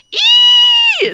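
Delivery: very high, loud held "ee"; screeching noise. Acted. Game master imitates a noise heard outside the door, screech. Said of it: "sound coming from, um, outside of the door".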